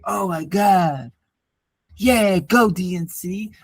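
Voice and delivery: slow, dopey mock excitement; mock fan cheer. Mocking convention fans cheering their party, slow dopey enthusiasm. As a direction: mimic a brainwashed party fan.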